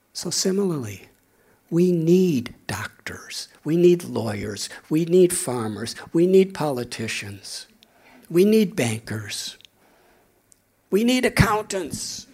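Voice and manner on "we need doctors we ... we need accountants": "need" stressed in every line. Acted. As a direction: lean on "need" each time. Lists professions, stressing need.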